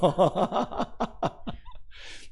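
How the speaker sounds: real laugh trailing from the line; amused laugh. Real, not acted. Pastor amused by the prayer request, hearty laugh. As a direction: laugh heartily at your anecdote. Amused by his own story.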